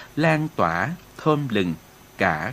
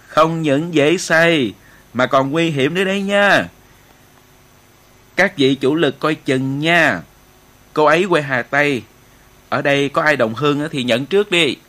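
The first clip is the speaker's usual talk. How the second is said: louder, higher jovial voice without real laughter; hearty, laughing retort; 3 semitones higher, 5 dB louder than usual. A narrator's reading. Station chief joking back to the officers, heartily. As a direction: banter loudly with the whole room. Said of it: "Anh trạm trưởng cười lớn".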